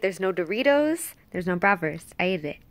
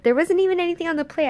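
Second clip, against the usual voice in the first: louder, much faster burst; exasperated rush; 8 semitones higher, 5 dB louder, much faster than usual. Real, not acted. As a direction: blurt it out in disbelief. Exasperated at the dog.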